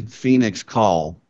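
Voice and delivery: ordinary talk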